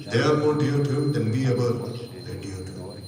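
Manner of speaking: ordinary delivery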